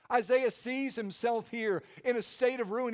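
ordinary talk

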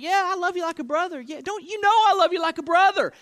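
high-pitched, protesting mock voice; hammed-up high, indignant reassurance; Peter. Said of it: "And Peter's like".

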